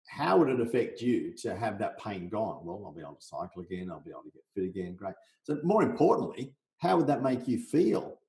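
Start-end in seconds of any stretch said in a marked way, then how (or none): 2.6-4.9 quick, softer voice for the client answering; lightly voiced eager client; hint of a client. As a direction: rattle off the client's hopes, quieter. Client role-played in sales chat.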